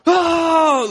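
loud, very high wail; imitated congregation cry. Congregation suddenly overcome by the Spirit, loud high cry. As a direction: mimic a whole crowd crying out. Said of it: "suddenly the whole congregation goes".

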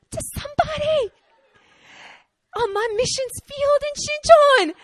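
very high, squealed lament; mock wail. Pastor confessing cursing on her mission field, high mock wail.

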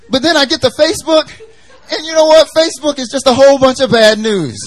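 high, loud, laughing mock wail; mock outburst. Preacher hamming up outrage at Facebook. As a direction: wail it like it hurts, half laughing.

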